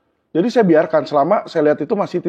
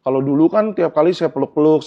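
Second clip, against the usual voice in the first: ordinary talk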